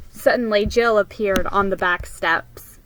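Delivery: ordinary delivery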